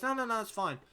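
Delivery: high put-on voice, no no no it's fine; breezy reassurance. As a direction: mimic the worker waving off the worry. Bloke reassuring him again.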